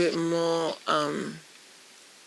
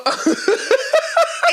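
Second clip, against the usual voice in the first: real cracking up. Real, not acted. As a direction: crack up completely. Cracks up over the catch-up.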